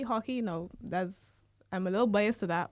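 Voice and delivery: ordinary talk, nothing marked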